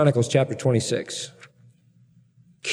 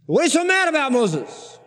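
incredulous questioner; 11 semitones higher than usual. Retold or imitated. People question angry Moses.

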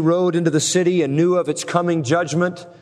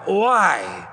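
painful guttural cry; 2 semitones higher than usual. Shown as a demonstration. Preacher showing the congregation the Greek word for woe, as a painful cry. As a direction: voice the word as a wailed "uai". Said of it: "sounds more like a painful, guttural cry".

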